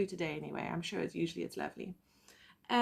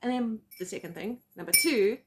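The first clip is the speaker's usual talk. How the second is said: ordinary talk, nothing marked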